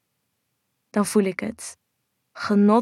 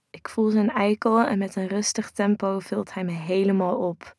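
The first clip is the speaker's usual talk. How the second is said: plain reading voice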